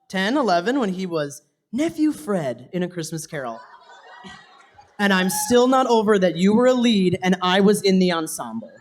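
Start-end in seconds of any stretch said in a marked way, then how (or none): none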